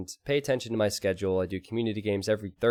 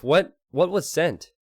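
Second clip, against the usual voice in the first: ordinary delivery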